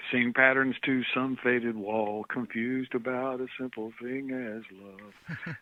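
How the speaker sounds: slow, drawn-out recital of a verse; lyric recitation. Acted. Quoting John's love song to a fellow musician, slow recitation. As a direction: savour each word of the lyric slowly.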